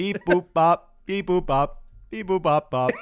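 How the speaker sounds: robotic beep boop chant; sing-song alien beeping. Pretend. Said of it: "Can you please sound like an alien?".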